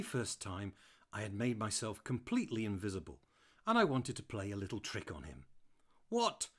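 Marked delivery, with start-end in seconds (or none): 6.1-6.5 much higher, loud startled call; shouting back. Acted. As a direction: yell it back from upstairs. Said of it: "I could hear Sally shout back".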